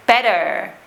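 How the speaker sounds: better with softened T, R held at the end; West Country pronunciation. Shown as a demonstration. Teacher repeating it for learners, West Country pronunciation. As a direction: lose the crisp T in better, curl the R. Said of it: "normally becomes what we call a glottal".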